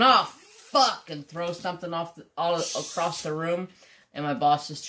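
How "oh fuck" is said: sudden loud high curse; retold outburst. Worker at the shop blurting a curse in frustration. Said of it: "I'm over off the side going".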